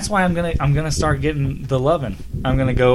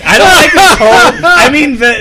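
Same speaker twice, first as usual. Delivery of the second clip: high, loud mock indignation; mock outrage; 11 semitones higher, 8 dB louder than usual. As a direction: pretend to be offended, hammed up.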